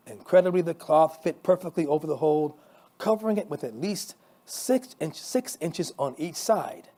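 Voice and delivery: usual speaking voice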